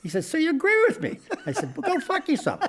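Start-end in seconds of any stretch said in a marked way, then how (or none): 0.2-1.2 high, loud mock questioning; oblivious older passenger. Older passenger questioning the comedian, high loud oblivious voice. Clueless passenger replies, retold. 1.8-2.6 high-pitched comic outburst; mock exasperated retort. Comic giving up on the passenger, exasperated high retort.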